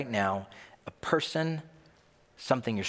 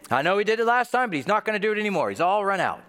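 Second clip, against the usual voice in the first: high, louder skeptical objection; doubting disciple; 8 semitones higher, 4 dB louder, faster than usual. Acted. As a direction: protest like a doubter sure it will fail. Acted doubting disciple whispering objections.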